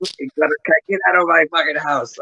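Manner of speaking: hammed-up mom chasing kids out; mock scolding mom. Mom throwing the kids out of the house, mock angry. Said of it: "I was like".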